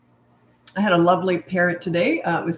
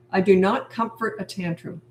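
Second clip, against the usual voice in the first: normal talking voice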